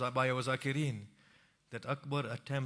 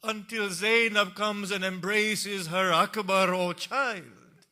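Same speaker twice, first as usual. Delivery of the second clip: high, loud mourning recitation; wailing sermon; 9 semitones higher, 12 dB louder, slower than usual. Acted. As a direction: lament at full force. Peak of lament.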